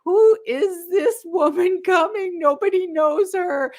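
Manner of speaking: imitating the others wondering about her; puzzled strangers. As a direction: play the confused party guests. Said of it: "I'm sure you all went".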